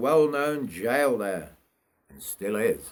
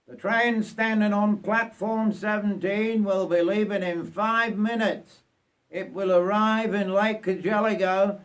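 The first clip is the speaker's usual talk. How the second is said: higher, formal announcer read; station announcement; 8 semitones higher than usual. Acted. Station announcer telling passengers to board, higher and formal. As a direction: call it out over the platform like a railway announcer.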